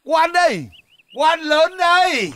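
high, stretched announcement; calling out. Acted. Ex-mandarin announcing himself to Đề, high drawn-out call. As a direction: announce yourself grandly from outside.

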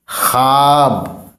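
ख्वाब said slowly as khaab, the waw not sounded; khaab, silent waw. Shown as a demonstration. Teacher slowly showing pupils the silent waw, khaab. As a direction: stretch ख्वाब out as khaab, no "khwa". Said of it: "ख्वा नहीं पढ़ेंगे".

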